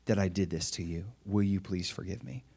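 bow your voice down and plead gently; humble apology. Retold or imitated. Parent apologizing to wife or child, humble and soft. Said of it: "we humble ourselves and we say".

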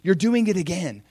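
high-pitched, delighted exclamation; happy relief. Acted. God seeing his people return, happy and relieved. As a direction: welcome it gladly, without shouting. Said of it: "I just see the happy heart of God".

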